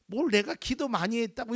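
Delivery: usual speaking voice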